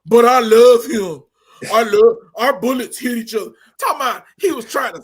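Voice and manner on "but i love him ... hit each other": louder, hammed-up weepy dad voice; mock tearful villain dad. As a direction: overact the sobbing father. Mocking the villain dad's words.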